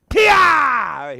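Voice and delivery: ordinary delivery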